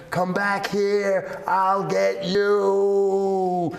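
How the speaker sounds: high, long drawn-out threat; menacing chasing call. Acted. Old Man Winter chasing the seasons, menacing drawn-out call. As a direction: call after them, stretching every word.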